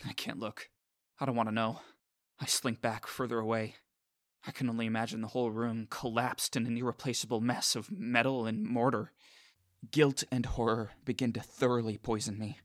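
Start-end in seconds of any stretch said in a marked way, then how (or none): none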